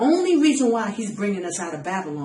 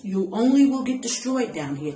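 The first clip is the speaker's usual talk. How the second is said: ordinary talk, nothing marked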